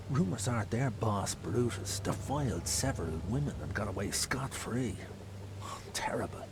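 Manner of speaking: low, quiet confiding; hushed fearful telling. Acted. Frightened man confiding rumours about Brutus, hushed. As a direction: keep your voice down, still afraid. Continues the secret.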